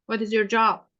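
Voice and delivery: normal talking voice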